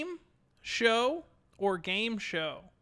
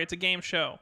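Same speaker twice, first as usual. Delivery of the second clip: ordinary delivery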